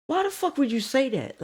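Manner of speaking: high-pitched, hushed indignant protest; indignant disbelief. Acted. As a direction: protest in a high, softened, incredulous voice. Acted indignant reaction to the girl.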